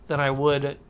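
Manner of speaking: ordinary talk, nothing marked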